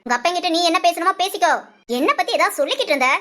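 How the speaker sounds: high-pitched angry scolding; threatening mother. Acted. Mother threatening son who tells on her, angry. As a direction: warn off a tattling child.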